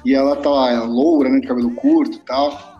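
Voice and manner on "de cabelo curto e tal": ordinary talk, nothing marked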